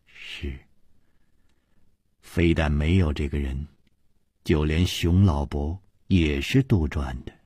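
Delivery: low, soft, measured admission; resigned Liu confessing. Acted. Liu admitting the old master was invented, subdued.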